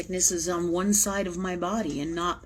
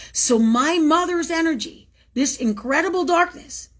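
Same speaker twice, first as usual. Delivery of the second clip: raised intense declaration; 9 semitones higher, 5 dB louder than usual. Real, not acted. Emotional climax.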